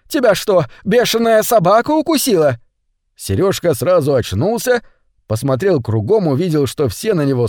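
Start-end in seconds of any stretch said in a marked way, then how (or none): 0.1-2.6 high-pitched, alarmed mother's exclamation; crying out. A narrator's reading. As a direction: demand an answer, high and worried. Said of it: "крикнула она". (высокий встревоженный возглас матери)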